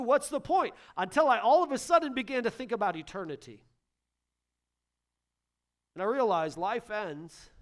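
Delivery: loud, high preaching that keeps building; full-force preaching. Acted. Pastor urging parents to value their families, loud fervent preaching. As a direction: preach it hard, like a revelation. Pastor wrestling with a question aloud.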